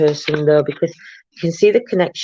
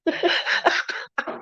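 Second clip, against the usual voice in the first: breathy laugh; 12 semitones higher, 6 dB quieter than usual. Real, not acted. Laughs at her own title.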